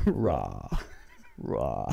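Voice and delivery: low growled dinosaur rah; dinosaur roar. Retold or imitated. Copying a workmate's dinosaur noise, low growled rah. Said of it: "he always walks around and just goes".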